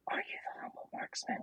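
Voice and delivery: quick, whispered re-enacted question; hushed, hurried, afraid of being wrong; hesitant whisper. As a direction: sneak the question out under your breath. Retells hesitantly asking after earlier embarrassment.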